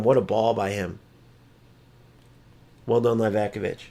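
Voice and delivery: ordinary talk, nothing marked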